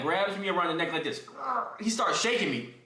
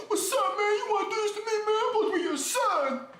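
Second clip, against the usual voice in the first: high-pitched, melodramatic tearful challenge; mock crying. Son confronting his dad, mock-tearful and high. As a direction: imitate a hurt teen crying as he argues. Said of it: "I was crying".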